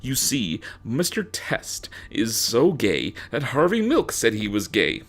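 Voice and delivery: ordinary talk, nothing marked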